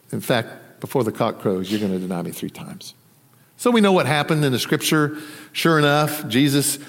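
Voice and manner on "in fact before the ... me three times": low, hushed retelling of a warning; quiet, grave prediction. Retold or imitated. Jesus telling Peter he will deny him, retold quietly. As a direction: drop the voice, softer and lower.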